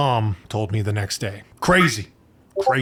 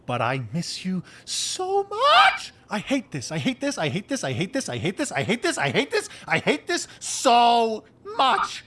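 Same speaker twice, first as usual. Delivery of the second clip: much higher, repeated whiny groans; whiny venting; 10 semitones higher than usual. Acted. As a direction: vent the frustration like a tantrum. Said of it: "I could scream".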